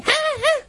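high mock cries; mock shouting. Woman cries out as he does kaparot, high mock cries. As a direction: squeal high and short. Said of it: "And she's shouting".

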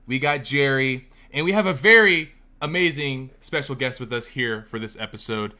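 usual speaking voice

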